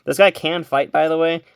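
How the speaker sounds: ordinary talk, nothing marked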